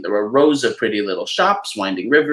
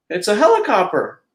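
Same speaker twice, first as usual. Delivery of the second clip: higher, cheerful mouse voice; Mickey explaining; 9 semitones higher, 3 dB louder than usual. Acted. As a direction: proudly show it off. Mickey names the machine.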